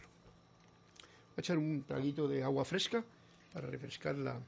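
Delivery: talking around a sip of water; words over a mouthful of water; while sipping a drink. Real, not acted. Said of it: "Gracias, agüita".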